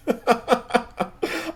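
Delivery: high, rapid chuckling; self-amused staccato laugh; real laugh. Real, not acted. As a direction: laugh it off in a high-pitched burst. Laughs about his subscriber count.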